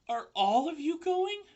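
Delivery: loud, high anxious question; distressed call; alarmed pig. A narrator's reading. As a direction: Wilbur pleading with the spiders. Wilbur alarmed.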